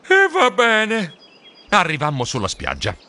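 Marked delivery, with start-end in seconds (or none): 0.0-1.1 high, stretched-out yawned words; sleepy yawning. A narrator's reading. Narrator agrees to go fishing at dawn, sleepy yawn. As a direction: yawn slowly through the words. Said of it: "Io sbadigliai assonnato".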